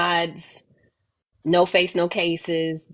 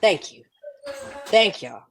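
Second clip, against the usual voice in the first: ordinary talk, nothing marked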